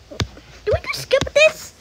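high, slow puzzled question; surprised high voice. Real, not acted. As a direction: squeak out the disbelief. Confused about a skip in the game.

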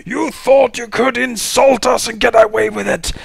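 hammed-up villain tirade, high and loud; Anrakin shouting. Villain threatening intruders and summoning dark powers, theatrical shout. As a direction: bellow it like a grandiose dark lord. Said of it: "Anrakin, uh, shouts out".

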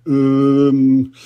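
held, loud sheepish um; Daddy Pig hesitating. Acted. Daddy Pig caught out about the garden, sheepish. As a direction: stall like a guilty dad. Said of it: "said Daddy Pig".

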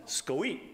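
"Scoeat" said as one word, skoh-eet, with a little rise; playful made-up word. Shown as a demonstration. Pastor telling the church after prayer, playful "Scoeat". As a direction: toss off "Scoeat" with a chuckle. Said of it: "I learned a new word".

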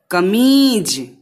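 कमीज said slowly with the मी held; slow clear word. Shown as a demonstration. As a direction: model कमीज slowly for the class. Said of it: "बहुत लंबा खींच रहे हो".

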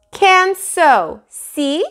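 put-on high, loud little boy voice; a toddler showing off, pitched high; childish insistence. Fudge proudly opens his mouth, high childish voice.

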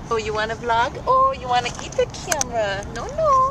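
high, playful cooing. Real, not acted. Cooing to a baby grabbing camera.

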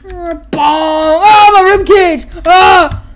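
high loud pained yelling. Retold or imitated. Toy hero hit by a falling block. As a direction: howl about the rib cage.

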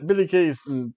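ordinary talk, nothing marked

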